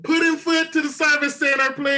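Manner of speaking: high, sing-out intercom page; store announcement. Acted. Prankster pages Pudding Foot to service center, announcer voice. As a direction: page a lost child over the store speakers.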